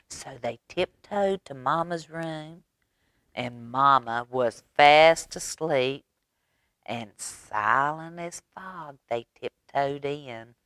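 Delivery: ordinary delivery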